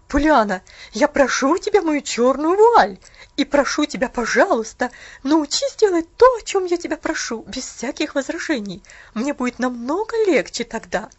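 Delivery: loud, exasperated scolding; irritated aunt. Acted. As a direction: insist loudly, fed up and bitter. Aunt scolding, insisting on veil.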